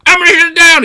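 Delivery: high, loud mock yell; mock shout. Dumb scientist yelling he'll shut the experiment down. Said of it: "the stupid guy was like".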